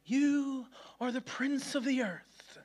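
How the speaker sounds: slow, high, grandiose praise; mock self-flattery. Preacher mocking the opposite of self-criticism, grandiose and drawn out. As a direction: flatter yourself absurdly, stretching it out slowly. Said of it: "the total opposite way on the spectrum".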